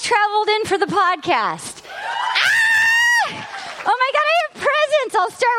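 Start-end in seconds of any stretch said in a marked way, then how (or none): 1.9-3.5 high squealing shriek; delighted shriek. Real, not acted. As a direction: squeal with glee.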